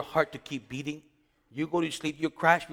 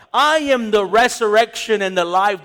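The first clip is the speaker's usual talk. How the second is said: loud, ringing declaration; proclaiming; 6 semitones higher, 10 dB louder than usual. Acted. Preacher voicing Jesus to Martha, loud proclamation. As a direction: announce it like a proclamation to the congregation.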